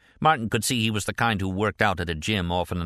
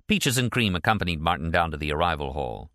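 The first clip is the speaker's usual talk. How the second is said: ordinary delivery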